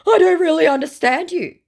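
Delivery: very high, loud put-on voice; hammed-up wail of incomprehension; mock baffled scammer.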